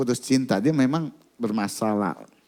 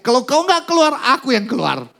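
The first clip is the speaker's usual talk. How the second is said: much higher, louder exasperated cry; frustrated shout; 10 semitones higher, 5 dB louder than usual. Retold or imitated. Worn-out exorcist threatens to leave himself, frustrated shout. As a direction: give up in exasperation. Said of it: "terakhir dia frustasi, dia bilang".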